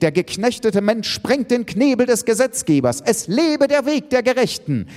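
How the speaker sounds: high, fervent proclamation; agitated. A narrator's reading. Elke proclaiming justice to the summit, agitated. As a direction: proclaim it like a rallying speech. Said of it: "entgegnet Elke erregt".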